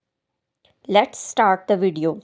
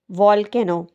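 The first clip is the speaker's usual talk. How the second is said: normal talking voice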